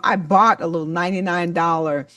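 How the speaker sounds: normal talking voice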